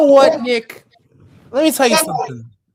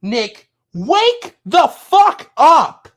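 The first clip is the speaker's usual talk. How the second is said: very slow, shouted through tears; tearful furious yell; angry shout; 2 semitones higher, slower than usual. Real, not acted. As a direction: yell at him to wake up. Angry crying outburst.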